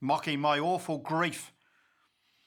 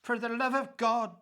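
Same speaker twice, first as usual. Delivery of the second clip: higher, strained plea for bread; starving wife groaning; 7 semitones higher than usual. A narrator's reading. Dying wife begs her husband for food, strained and high. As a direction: beg for a crust as if starving. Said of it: "she groaned".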